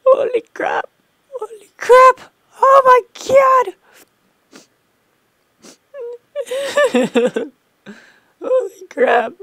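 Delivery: very high, loud, crying shriek; real terrified scream; shrieking holy crap. Real, not acted. Player shrieking after a sudden death in the game.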